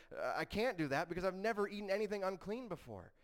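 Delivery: Peter. Retold or imitated. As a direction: plead your spotless record in protest. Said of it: "Peter is really struggling with this because he says".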